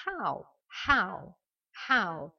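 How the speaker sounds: "how" with an audible H, repeated apart; sounded H. Shown as a demonstration. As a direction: punch out "how" with a breathed H. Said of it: "on le prononce". (« how » avec le H bien prononcé)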